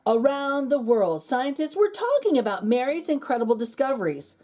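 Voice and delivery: lively storybook narration with dramatic pauses; animated read-aloud for kids; reading to children. A narrator's reading. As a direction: read it like a bedtime picture book. Reads picture book aloud.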